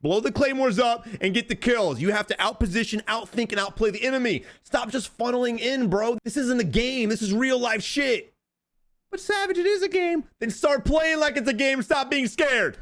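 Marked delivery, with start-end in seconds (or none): 0.0-8.3 heated rant. Real, not acted. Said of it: "I'm getting heated". 9.1-10.2 high squeaky mock viewer voice; mock whiny viewer. Coach mocking a viewer who fears claymores, high squeaky voice. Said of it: "mock viewer question".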